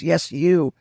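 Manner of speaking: ordinary talk, nothing marked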